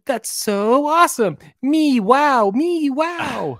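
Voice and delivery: high, slow, excited cartoon cat. Cats in the YouTube cartoon cheering, a high cartoon voice. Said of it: "they're like".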